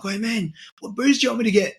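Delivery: excited. Retold or imitated. Excited boys' trip phone call.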